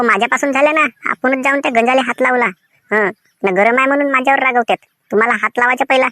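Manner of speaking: usual speaking voice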